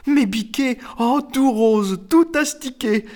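very high, incredulous squeal; astonished exclamation. A narrator's reading. Ruby recognises the scrubbed children, exclaiming in high amazement. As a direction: marvel at them in a high, drawn-out voice. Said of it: "et s'exclama".

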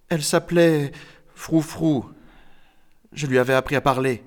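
put-on small, halting, stricken child; trembling voice. A narrator's reading. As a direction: say it haltingly, close to tears. (voix d'enfant tremblante)

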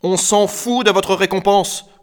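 put-on high, loud child's outburst; defiant shout. A narrator's reading. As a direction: shout it, throwing the reward away. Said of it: "cria quelque chose". (cri de défi, aigu et fort)

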